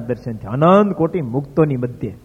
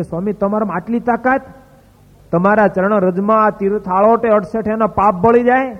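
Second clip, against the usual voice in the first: higher, amazed asking; can you really have such power, in wonder; stunned disbelief, voice lifted; astonished question; 6 semitones higher, 3 dB louder than usual. Retold or imitated. Astonished boy questions the swami.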